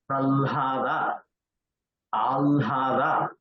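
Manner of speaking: slow "pralhada, alhada" with the l held before the h; "pralhada, alhada" slowly, leaning on l-h; correct "pralhada". Shown as a demonstration. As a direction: draw out "pralhada, alhada", l first, then h. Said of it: "it is pralhada".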